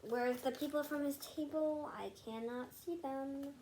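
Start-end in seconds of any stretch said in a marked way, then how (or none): none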